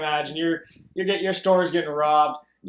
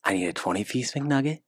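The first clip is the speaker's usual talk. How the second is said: lower, softer leaning-in order; hushed order; 7 semitones lower, 5 dB quieter than usual. Retold or imitated. Retelling a drive-thru order, mock hushed voice. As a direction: say it hushed into the intercom. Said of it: "trying to whisper into their intercom".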